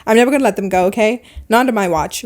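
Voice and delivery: ordinary delivery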